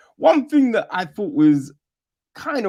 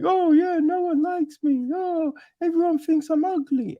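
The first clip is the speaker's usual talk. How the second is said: high mock self-pitying voice; mocking whiny girl; 12 semitones higher than usual. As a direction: parody a girl fishing for pity, pitched way up. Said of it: "she's like".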